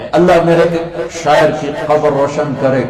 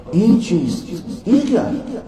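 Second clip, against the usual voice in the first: bewildered question; 5 semitones higher, 4 dB quieter than usual. Acted. Rumi asks over dried books.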